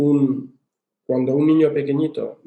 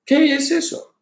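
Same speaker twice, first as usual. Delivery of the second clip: put-on high, small child voice asking; a child's bewildered question in a much higher voice; puzzled child; 13 semitones higher than usual. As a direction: voice a bewildered little kid. Child puzzled by a failing grade.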